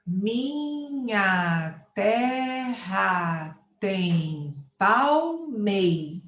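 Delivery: very slow, every syllable stretched out; escansão poética. Shown as a demonstration. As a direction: scan the verse syllable by syllable, stopping on "palmei". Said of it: "Eu conto só até a última sílaba tônica". (escandindo bem devagar, alongando cada sílaba)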